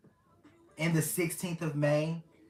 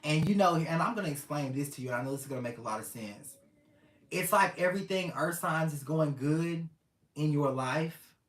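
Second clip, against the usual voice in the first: ordinary talk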